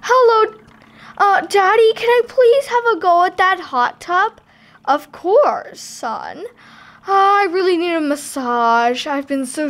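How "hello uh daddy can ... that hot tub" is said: put-on kid voice. Child doll voice.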